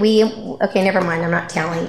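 normal talking voice

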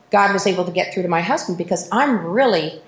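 ordinary talk

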